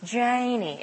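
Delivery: "Janie" with a soft Southern drawl; a sweet Southern "Janie". Shown as a demonstration. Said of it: "my name sound much more sweet".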